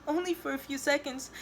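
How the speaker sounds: high, small apologetic voice; embarrassed whimper. A narrator's reading. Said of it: "She lowers her head in embarrassment".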